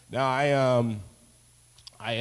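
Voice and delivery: normal talking voice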